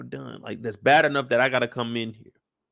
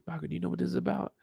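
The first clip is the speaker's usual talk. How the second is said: hushed question; 5 semitones higher, 10 dB quieter, much faster than usual. Retold or imitated. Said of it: "She said".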